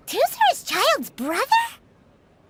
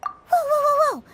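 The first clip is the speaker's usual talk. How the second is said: alarmed whoa; 8 semitones higher, 4 dB louder than usual. Real, not acted. Stops a fight breaking out.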